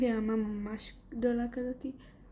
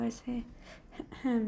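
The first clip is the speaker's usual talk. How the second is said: ordinary talk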